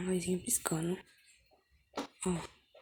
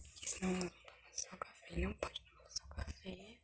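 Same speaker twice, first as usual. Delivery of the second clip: whispering; 5 dB quieter than usual. Real, not acted. Whispers through her doll play.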